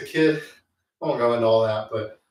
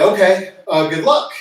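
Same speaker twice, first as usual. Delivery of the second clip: mock cocky reply; 6 semitones higher, 8 dB louder than usual. Retelling his reply to the nurse.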